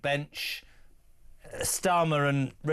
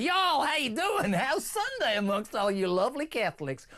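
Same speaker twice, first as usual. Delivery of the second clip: mock American accent; 9 semitones higher than usual. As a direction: call out like an American Pope, high-pitched. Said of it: "Where did that accent come from?".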